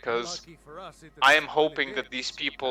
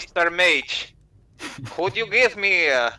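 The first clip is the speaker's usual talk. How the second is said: bard character voice; 5 semitones higher, 3 dB louder than usual. Pretend. In-character plea to a mage.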